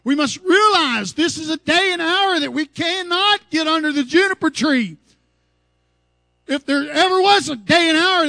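loud, ringing sermon delivery; full-force preaching. Acted. Preaching to the congregation.

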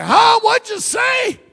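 high, loud, hard-of-hearing query; imitated near-deaf old man. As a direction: call it out like someone who can't hear. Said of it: "He was near deaf".

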